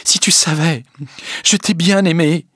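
yearning, plaintive; sighing. A narrator's reading. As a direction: sigh out a pained love confession. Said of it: "Elle soupira".

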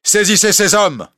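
l'évêque aboie un ordre, plus haut et plus fort; ordre crié. A narrator's reading. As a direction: commande tes soldats d'une voix forte. L'évêque donne l'ordre aux soldats. (l'évêque ordonne à ses soldats de saisir les hommes, en criant)

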